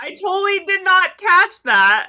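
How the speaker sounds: high, loud disbelief; shocked outburst. Real, not acted. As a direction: blurt it out, stunned. Stunned she missed the detail.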